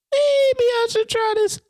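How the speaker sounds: very high slow musing voice; high playful voice. Retold or imitated. Quoting own thought, high voice.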